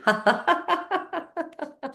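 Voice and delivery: high laugh. Real, not acted. Tapping with laughing.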